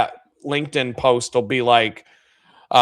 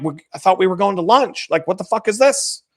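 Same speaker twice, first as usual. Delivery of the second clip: retold baffled outrage; 6 semitones higher than usual. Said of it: "I'm like".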